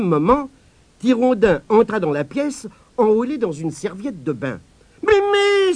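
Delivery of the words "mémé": put-on high, loud child's cry of alarm. A narrator's reading. Boy bursting in on his grandmother, crying out. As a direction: shout for grandma in a panic. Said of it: "s'écria-t-il".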